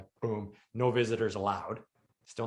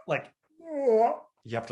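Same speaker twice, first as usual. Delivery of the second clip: sharp gasp, then words slowed right down; pained gasp; 13 semitones higher, 4 dB louder, much slower than usual. Retold or imitated. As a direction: gasp as the tube comes out, then drag the words. Tube pulled out, gasping.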